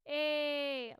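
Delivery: belted twangy A, squeezed at the back; edge on A. Shown as a demonstration. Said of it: "the back is still really squeezed as if I'm talking".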